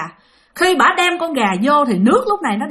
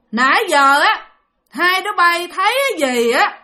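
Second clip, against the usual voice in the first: higher, heavily leaned-on words; menacing, pressed voice; 6 semitones higher than usual. Retold or imitated. Woman questions the hiding children, pressing menacingly. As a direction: press each phrase like a threat. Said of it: "Mà bà nói dằn dằn cái giọng nha".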